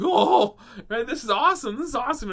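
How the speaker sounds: high, loud fake enthusiasm hiding fear; feigned excitement. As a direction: overdo the delight to cover being scared. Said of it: "didn't wanna just let him know that I was scared".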